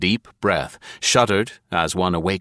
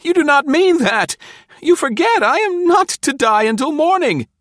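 high, loud, desperate protest; panicked hoarse cry; 6 dB louder than usual. A narrator's reading. Spy protesting execution before morning, panicked cry. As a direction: cry out in terror, far above your usual pitch. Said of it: "he cried hoarsely, almost inarticulately".

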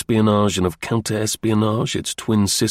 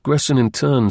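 ordinary delivery

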